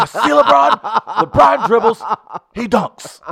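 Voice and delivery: put-on high loud old-man Bernie call; Bernie doing highlights. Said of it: "Bernie Sanders ... co-host SportsCenter".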